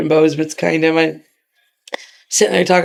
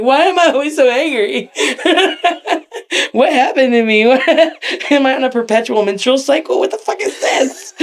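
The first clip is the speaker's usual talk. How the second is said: higher, laughing complaint; laughing rant; 6 semitones higher than usual. Retold or imitated. Wrestler mocks her own constant anger, laughing. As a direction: rant about yourself while laughing. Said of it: "I'm like".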